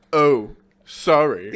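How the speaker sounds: slow, drawn-out mock apology; hammed-up slow oops; fake innocent apology. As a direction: stretch it like a bad actor covering a trick. Imitating fake clumsy apology.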